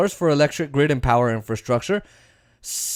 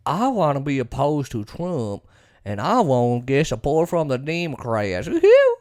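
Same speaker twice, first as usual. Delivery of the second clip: Josh Hawley; 3 semitones lower, 3 dB quieter than usual. Acted. Senator going with the times, mocking impression. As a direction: play a slippery politician, then whoop. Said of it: "Josh Hawley is just a guy that goes with the times".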